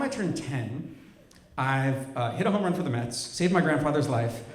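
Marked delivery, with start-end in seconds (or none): none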